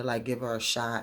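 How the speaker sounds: ordinary talk, nothing marked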